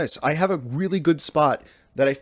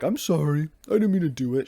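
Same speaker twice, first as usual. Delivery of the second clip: lightly voiced apologetic dog, slightly raised pitch; voicing the dog; 4 semitones higher than usual. Dog's look after being told off, mock apology. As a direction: play a guilty pet saying sorry. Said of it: "She doesn't talk like that".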